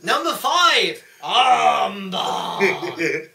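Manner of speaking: high, loud, slow, drawn-out countdown; milk each number like a showman; Jericho list promo. Retold or imitated. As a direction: belt out the list to a crowd. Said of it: "I love his promo".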